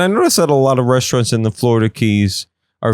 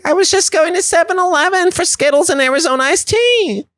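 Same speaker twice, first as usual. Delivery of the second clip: very high, squeaky fairy voice; Tinkerbell. Acted. Shot fairy toy protesting to the shooter, high squeaky plea. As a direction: plead in a tiny, high-pitched put-on voice. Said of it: "Tinkerbell's like".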